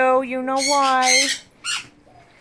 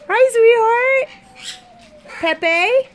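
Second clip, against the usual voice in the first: very high, cooing pet talk; sweet pet voice; 9 semitones higher, 6 dB louder, much slower than usual. Real, not acted. Greeting her parrot Pepe in a very high cooing voice. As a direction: coo up high at the bird.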